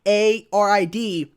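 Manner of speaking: mock spelling out the spam; slow mocking spelling, letter by letter; slowly spelled. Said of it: "spammed me in my D M".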